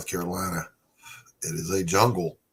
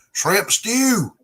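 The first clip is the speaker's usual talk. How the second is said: "Shrimp stew!" in an exaggerated Arkansas Southern drawl, higher and louder; imitating the Arkansas Southern way of saying "Shrimp stew!"; mock Arkansas Southern accent, spoken loudly with a laugh; 13 semitones higher, 7 dB louder than usual. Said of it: "unusual Arkansas Southern accent".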